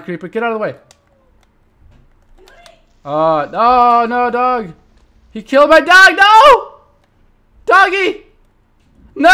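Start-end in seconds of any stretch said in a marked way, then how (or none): 3.0-9.3 high, loud cries of no; anguished yell. Real, not acted. Gamer crying out as his dog dies. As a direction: wail over the lost dog.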